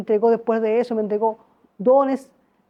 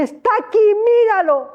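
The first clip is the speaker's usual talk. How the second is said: excited exclaim; 9 semitones higher, 4 dB louder than usual. Retold or imitated. Excited telling of seeing Jesus.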